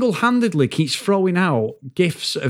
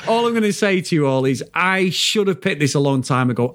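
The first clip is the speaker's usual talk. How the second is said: ordinary delivery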